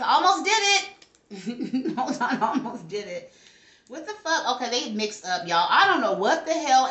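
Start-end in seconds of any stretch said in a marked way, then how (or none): 0.0-2.0 drawn-out, stunned, half laughing; slow disbelief. Real, not acted. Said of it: "Did I just almost flip these cards?". 2.0-3.3 laughing through the words. Real, not acted. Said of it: "Did I just almost flip these cards?".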